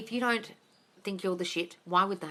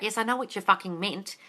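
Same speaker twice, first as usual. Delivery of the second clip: usual speaking voice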